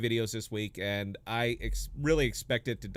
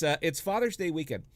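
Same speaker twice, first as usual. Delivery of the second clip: usual speaking voice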